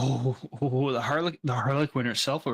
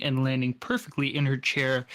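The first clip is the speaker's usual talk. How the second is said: usual speaking voice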